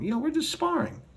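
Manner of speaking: mincing high mock voice; mocking excuse. Mocking Max's sparring excuse, high mincing voice. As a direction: squeak it out like a flimsy excuse.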